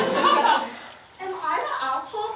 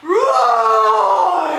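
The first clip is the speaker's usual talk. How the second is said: loud roaring dragon; 9 dB louder than usual. Acted. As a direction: roar like a waking dragon. Dragon lord awakens.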